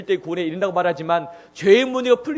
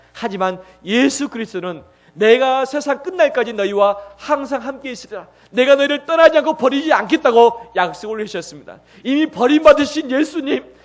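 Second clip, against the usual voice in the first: loud, high, impassioned preaching; preaching at full force; 7 semitones higher, 10 dB louder than usual. Acted. Preacher proclaiming the cross to his congregation, loud and impassioned. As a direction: proclaim it to the whole church.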